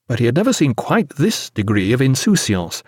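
plain reading voice

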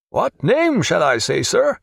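lightly put-on servant's polite question; maidservant asking. A narrator's reading.

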